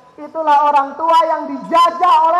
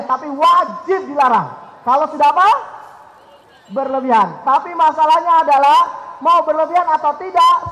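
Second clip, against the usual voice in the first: normal talking voice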